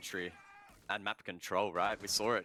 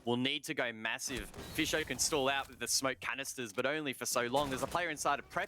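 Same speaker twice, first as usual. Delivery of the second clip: esports caster; 4 semitones higher than usual. Acted. Live round play-by-play.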